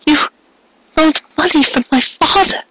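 high, tearful repeat; tinged with tears. Acted. Cupcake repeating to Carrot, tearful. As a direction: say it again on the edge of crying. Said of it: "tinged with tears".

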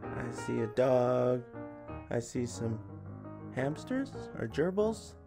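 plain reading voice

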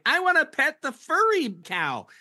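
high, drawn-out mock tourist voice; gushing tourist. Tourist spotting wildlife at Yellowstone, high hammed-up gushing.

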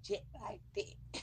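slow whispered tag. Shown as a demonstration. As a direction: hush it out slowly. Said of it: "See how I make that ass jet".

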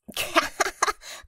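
high unvoiced laughter; breathy laugh. A narrator's reading. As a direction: shake with laughter. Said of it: "sich vor Lachen schüttelnd". (lautloses Lachen)

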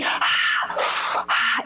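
heavy, deliberate breaths; demonstrated breathing. Shown as a demonstration. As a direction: breathe audibly to show it's alive. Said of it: "It breathes".

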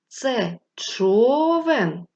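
човен with the first syllable ЧО stretched long; slow asking voice, ЧО held long; stress on ЧО. Shown as a demonstration. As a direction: draw out ЧО in човен like a question. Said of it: "Який склад ми протягували довше?".

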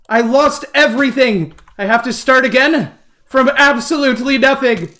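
high-pitched lament over lost work; loud-ish, despairing whine; distraught wail. Real, not acted. Lost all progress.

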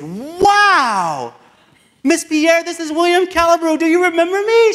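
put-on overjoyed to hear his old teacher's voice; excited greeting. Calling his old teacher, a high, loud, excited hello.

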